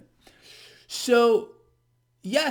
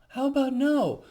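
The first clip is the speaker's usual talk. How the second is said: sarcastic; 7 semitones higher, 4 dB louder than usual. Real, not acted. Sarcastic refusal of surprise.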